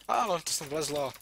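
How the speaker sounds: high, loud alarmed yes; startled exclamation. Real, not acted. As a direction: react sharply as the mob gets in. Mob crawled in, startled.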